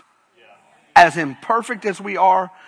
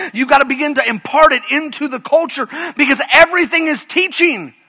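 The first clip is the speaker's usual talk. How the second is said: fired-up preaching; 7 semitones higher, 5 dB louder than usual. Acted. Pastor rallying his church, preaching with rising force. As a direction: pitch climbing, punchy preacher cadence.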